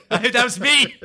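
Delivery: gleefully confessing to the boss, laughing; laughing admission. Retold or imitated. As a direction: own it through laughter.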